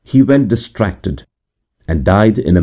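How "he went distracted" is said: usual speaking voice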